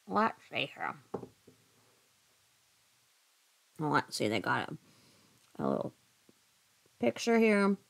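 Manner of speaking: talking through a mouthful of marshmallows; words round a mouthful; mouth full. Real, not acted. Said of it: "talking like I have a mouthful of marshmallows".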